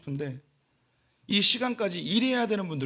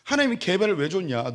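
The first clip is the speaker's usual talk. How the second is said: ordinary talk, nothing marked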